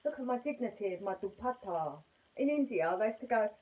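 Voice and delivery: ordinary talk, nothing marked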